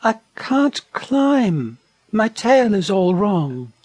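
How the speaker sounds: high, slow little voice; sweet, plaintive wallaby. Acted. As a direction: explain it high and drawn out. Wallaby answering from the ground.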